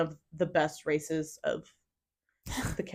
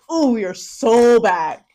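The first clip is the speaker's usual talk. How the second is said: excited; 4 semitones higher, 8 dB louder, slower than usual. Retold or imitated. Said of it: "I said".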